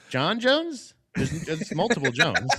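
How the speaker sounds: mock confusion with a laugh; laughing disbelief. Real, not acted. Podcaster laughing at [name]'s vague answer, incredulous.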